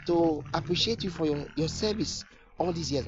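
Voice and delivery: astonished question. Acted. Contractor asks the weeping builder why he cries, astonished. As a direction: pitch way up in bewildered surprise.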